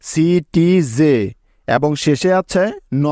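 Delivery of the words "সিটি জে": সিটি জে said slowly with a rise; teacher blending সিটি জে slowly. Shown as a demonstration. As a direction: stretch সিটি জে while joining it. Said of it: "মিলে পেলাম জে".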